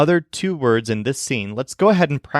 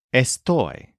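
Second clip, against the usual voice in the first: estoy with the second syllable stressed; stress on TOY. Shown as a demonstration. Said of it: "with a stress on toy".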